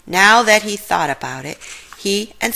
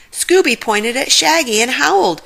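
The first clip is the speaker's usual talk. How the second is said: usual speaking voice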